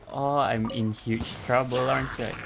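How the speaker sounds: ordinary talk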